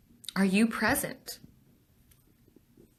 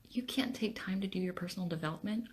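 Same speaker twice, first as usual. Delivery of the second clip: quick, whispered questioning; hushed disbelief; 11 dB quieter, faster than usual. Real, not acted. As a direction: drop to a fast incredulous whisper. Voicing an excuse in disbelief.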